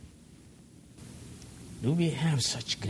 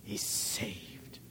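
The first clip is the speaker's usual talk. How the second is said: softer, lower, breathed; whisper; 5 semitones lower, 8 dB quieter than usual. Real, not acted. Preacher answering his own question, whisper. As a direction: answer in a hush.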